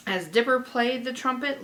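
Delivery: usual speaking voice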